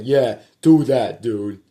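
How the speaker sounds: loud, slow taunting yell; slow loud jeer; mocking shout. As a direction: goad him loudly. Brother taunting, quoted.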